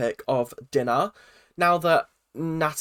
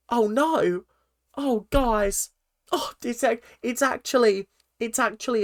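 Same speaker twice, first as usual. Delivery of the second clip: high-pitched mock distress in short, halting bursts; hammed-up fake heartbreak, pitched high; phony put-on act; 7 semitones higher than usual. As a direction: imitate someone badly faking being overcome. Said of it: "suddenly Collins turns on the act".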